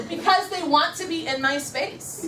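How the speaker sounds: slow, high, emphatic declaration; powerful affirmation. Shown as a demonstration. Trainer leads the group's affirmation, slow and powerful. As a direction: spell it out grandly for echoing. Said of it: "repeat after me with the same inflection".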